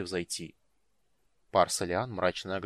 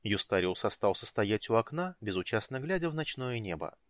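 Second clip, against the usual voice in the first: usual speaking voice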